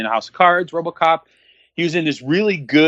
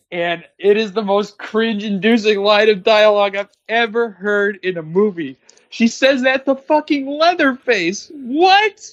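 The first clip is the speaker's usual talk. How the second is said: high-pitched, exasperated disbelief; incredulous rant; 8 semitones higher than usual. Real, not acted. Mocking the most cringe-inducing movie line, incredulous rant. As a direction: climb higher in disbelief up to a squeaked last word.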